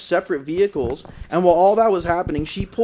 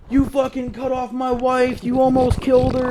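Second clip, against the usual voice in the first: mock angry rant, pitched up on one high level; angry accuser; 8 semitones higher than usual. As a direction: imitate an irate man accusing, higher-pitched. Retold angry confrontation at window.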